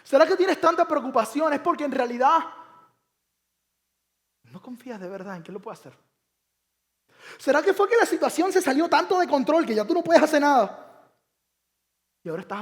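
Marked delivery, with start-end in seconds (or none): 0.0-11.1 loud, high preaching build; fervent preaching. Acted. Preacher calling out to the congregation, loud and fervent. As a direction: raise the voice and plead with the congregation.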